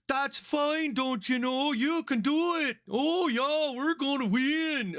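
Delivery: high, chirpy mock Canadian accent. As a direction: ham up a cheerful Canadian. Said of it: "try to mimic their accent".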